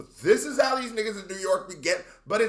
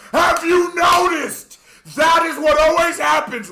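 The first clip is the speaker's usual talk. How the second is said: much higher and louder, shouting at chat; angry rant shout; 11 semitones higher, 9 dB louder than usual. Real, not acted. Scolding chat for judging British rap unheard, shouting rant. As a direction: yell it like you're fed up with them. Ranting at chat about ignorance.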